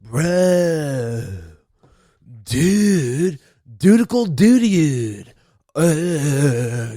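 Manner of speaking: drawn-out stoner-bro doctor; goofy character voice, slow and drawling. Pretend. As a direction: stretch the bro words into long groans. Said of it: "Fucking Doctor Uh".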